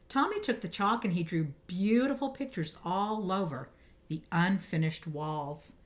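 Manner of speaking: usual speaking voice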